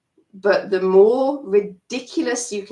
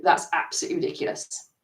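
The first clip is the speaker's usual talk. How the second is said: dismissive; 4 semitones lower, 4 dB quieter, faster than usual. Shown as a demonstration. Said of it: "it's gonna move to".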